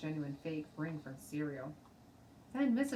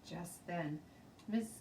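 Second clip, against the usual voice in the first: ordinary delivery, nothing marked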